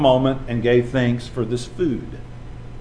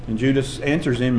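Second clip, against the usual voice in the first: usual speaking voice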